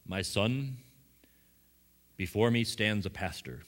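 put-on a touch of a solemn old bishop voice; lightly intoned, like a bishop giving a blessing; imitating the bishop. The bishop greets the young preacher, lightly voiced and solemn. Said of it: "that's how he talks".

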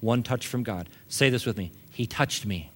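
soft, low preaching voice with long pauses; gently minister to the congregation, low and soft; hushed ministry appeal. Real, not acted.

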